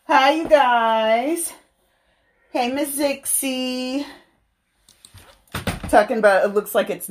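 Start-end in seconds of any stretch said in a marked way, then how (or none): 0.1-4.1 bright stream greeting. Real, not acted. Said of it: "greeting stream viewers".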